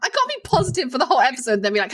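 ordinary talk

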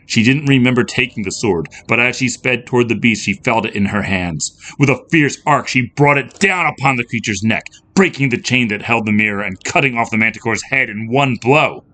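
usual speaking voice

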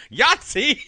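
high, loud exclamation; hammed-up villain's cry of triumph; triumphant mock shout. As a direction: yell it like a sudden eureka.

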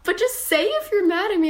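high pleading friend voice. Retold or imitated. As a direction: beg her to be honest. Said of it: "Filippa bara". (bedjande väninna)

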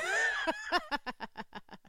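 high squealing laugh; sudden high-pitched giggle fit; burst of laughter. Real, not acted. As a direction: crack up with a high shriek of laughter. Friend's joke about accent.

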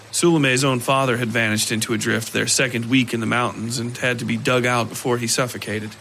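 usual speaking voice

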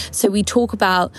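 ordinary talk

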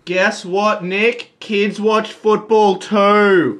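loud, high, slow yell. Real, not acted. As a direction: shout it across the room, slowly. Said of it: "Don't yell".

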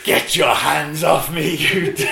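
loud, high, drawn-out movie-hero outcry; Planet of the Apes impression. Comic retelling of hearing English, mock-dramatic slow outcry. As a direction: imitate a stunned, outraged film line, slowly and loudly. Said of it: "Planet of the Apes".

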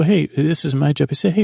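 ordinary talk

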